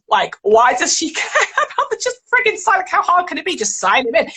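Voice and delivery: high-pitched, mock-exasperated imitation; exasperated staff. Nursery staff grumbling about a demanding mother, exasperated. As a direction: imitate someone baffled by a fussy customer.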